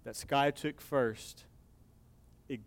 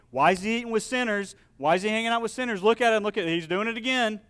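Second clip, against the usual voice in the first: higher, louder indignant Pharisee whining; mock grumbling; 8 semitones higher, 7 dB louder, faster than usual. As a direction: mimic a scandalized gossip. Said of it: "They're grumbling".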